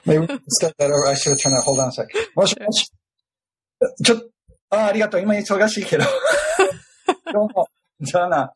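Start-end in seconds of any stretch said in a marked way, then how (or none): 2.4-8.5 answering a phone call in Japanese, higher pitch; off-mic phone chat, higher voice; turning away from phone. Real, not acted.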